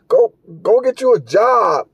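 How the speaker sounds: ordinary talk, nothing marked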